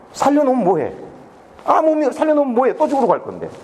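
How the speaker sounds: high-pitched, frustrated rhetorical question; exasperated lament. Retold or imitated. Official despairs that saving the girl changes nothing, exasperated lament.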